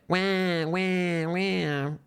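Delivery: mock a crybaby with a fake wah; sarcastic wah wah wah in a fake whine; mock crying. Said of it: "Cry about it".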